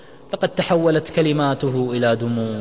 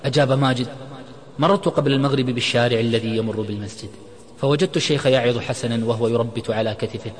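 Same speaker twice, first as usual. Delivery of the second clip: ordinary delivery, nothing marked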